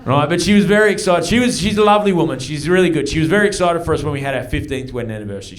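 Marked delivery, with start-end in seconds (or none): none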